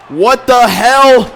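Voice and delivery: slow, loud, very high yell of disbelief; high, loud, stretched-out gamer outrage; shocked yell. Real, not acted. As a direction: scream at the screen in shock. Opponents convert on fourth down.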